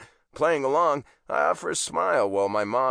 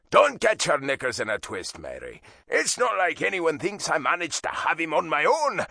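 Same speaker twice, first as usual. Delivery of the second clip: put-on Irish accent; 6 semitones higher than usual. A narrator's reading. Dad retorting.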